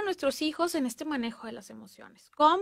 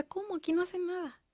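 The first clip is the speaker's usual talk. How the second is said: surprised grandma; 5 semitones higher, 7 dB quieter than usual. Retold or imitated. Grandma surprised at the children's chores.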